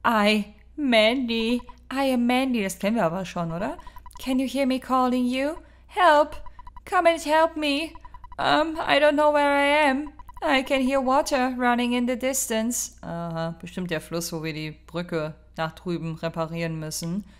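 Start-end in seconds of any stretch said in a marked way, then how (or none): 0.1-2.6 high, slow theatrical voice; put-on character voice. Player voicing a game character introducing herself, high and slow. 4.2-13.0 put-on high, loud calling girl voice; pleading lost girl. Player reading a lost girl's call for help, high and pleading.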